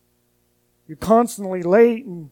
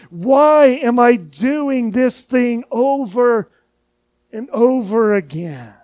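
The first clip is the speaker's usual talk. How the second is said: slow self-question; slower than usual. Retold or imitated. Asking oneself about repeated sin.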